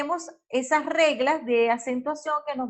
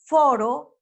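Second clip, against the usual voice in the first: loud "foro", punched on first "fo"; stress on penultimate syllable; 7 dB louder than usual. Shown as a demonstration. Teacher gives an example of graves, loud "foro" stressed on "fo". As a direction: hit "fo" hard in "foro". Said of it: "el acento fonético recae sobre la penúltima sílaba".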